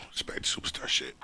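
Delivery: quick whisper under the breath; whispered aside. Real, not acted. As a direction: mutter it fast and low. Muttered swear under breath.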